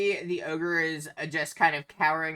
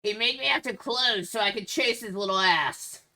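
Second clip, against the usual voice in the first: higher-pitched, complaining ogre voice; aggrieved ogre; 6 semitones higher, 5 dB louder than usual. Acted. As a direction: complain indignantly as a wronged ogre. Game master plays the aggrieved ogre.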